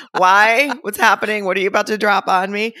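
high, loud retold questions; playfully panicked questioning, loud and high; mock alarm. Acting out her alarmed questions.

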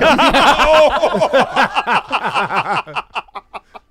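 long, high, loud burst of laughter; big belly laugh. Real, not acted. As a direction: crack up helplessly, high and loud. Cracks up after a joke.

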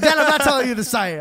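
high, loud retold outburst; exasperated protest. Son refusing to tell dad the site, exasperated protest. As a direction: shout down the embarrassing question.

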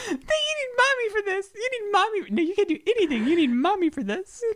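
high-pitched cooing dog-mommy voice; mock babying voice. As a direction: tease in a very high baby-talk voice. Mocking a babying dog owner.

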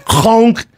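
imitated bite sound effect; chomp noise. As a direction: punch out a comic chomp. Imitates claws being bitten off.

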